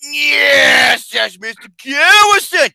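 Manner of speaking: high, loud, drawn-out cry; acted shout. Host playing someone answering Mister Garrison, high acted shout. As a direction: belt out a stretched yes. Acting out a figure in the bit.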